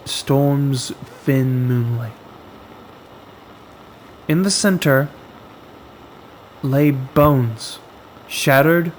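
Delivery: ordinary delivery, nothing marked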